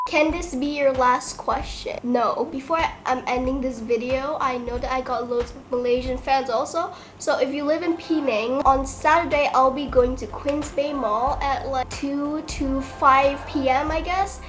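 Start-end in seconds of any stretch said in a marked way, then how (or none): none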